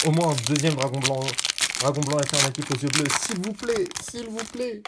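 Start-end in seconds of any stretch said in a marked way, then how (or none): 3.2-3.9 high-pitched plea at normal volume; pleading. Real, not acted. Player hoping for a dragon blanc alternatif, high pleading. Begging luck for a rare card. 4.0-4.8 small, high, hushed plea; soft pleading. Real, not acted. Player hoping for a dragon blanc alternatif, soft high pleading. Repeats the plea more softly.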